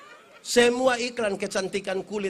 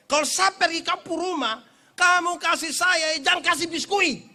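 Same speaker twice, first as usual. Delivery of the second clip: emphatic request; 10 semitones higher, 5 dB louder than usual. Retold or imitated. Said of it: "Saya bilang begini".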